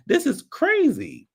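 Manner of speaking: high, slow disbelief; incredulous. Real, not acted. Reviewer stunned by the season, high and slow. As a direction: stretch it out in disbelief. Exasperated about the show.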